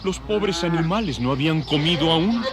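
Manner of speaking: ordinary delivery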